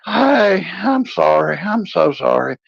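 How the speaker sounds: a lightly voiced drunk apology; sheepish drunk apology. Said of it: "I'm like".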